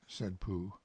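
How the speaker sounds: plain reading voice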